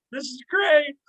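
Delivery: high, loud mock cheer from a gleeful fan; gleeful fan whooping. Yankee fan gloating at the tour guide, high loud mock cheer.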